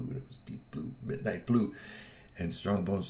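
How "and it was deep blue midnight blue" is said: hushed awe. A narrator's reading. Narrator marvelling at the midnight blue bike, whispered. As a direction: breathe the words in wonder.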